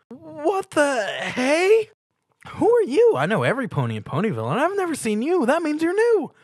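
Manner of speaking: very high, loud bubbly pony voice; Pinkie Pie. A narrator's reading. Pinkie Pie greeting Stuart with a loud high squeal. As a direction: squeal the welcome like an overexcited pony.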